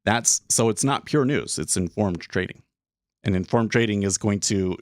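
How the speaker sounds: ordinary delivery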